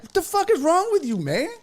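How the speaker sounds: fast, very high-pitched scolding; incredulous outburst. Retold or imitated. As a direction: squawk it in exasperated disbelief. Retells outburst at the joker.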